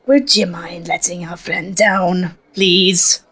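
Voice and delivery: ordinary talk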